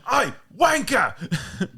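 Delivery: high, loud, drawn-out bellow; mock yelling. Crewman banging on doors, mock yelling. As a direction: holler it slowly, hammed-up. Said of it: "He's, like, yelling, banging on their doors".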